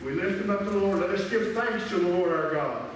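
ordinary talk, nothing marked

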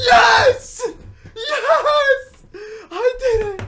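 very loud, high shrieks of triumph; ecstatic screaming. Real, not acted. Gamer finally pulling a diamond, ecstatic screaming. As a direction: scream it like you finally won.

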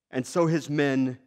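usual speaking voice

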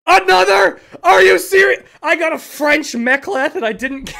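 very loud, high disbelief; real loud outrage; exasperated shout. Real, not acted. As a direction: shout at the screen. Yet another wrong drop.

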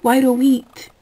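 loud high impatient question. Retold or imitated. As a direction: bark the question at them. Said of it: "I'm like".

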